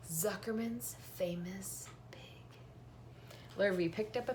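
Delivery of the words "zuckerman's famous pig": slow, softer whisper; reverent whisper. Acted. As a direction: hush it like a treasured title. Proudly naming the crate lettering.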